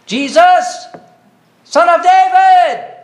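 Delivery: loud, high-pitched, drawn-out call for help; crying out. Retold or imitated. Blind beggar calling to Jesus as he passes, crying out. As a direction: call out urgently to someone far off. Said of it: "he cried out".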